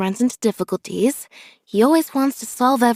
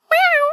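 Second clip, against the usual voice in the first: a much higher, louder little meow; cat meow; 5 dB louder than usual. Shown as a demonstration. Showing how a happy kitty sounds, a high meow. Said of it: "For a kitty".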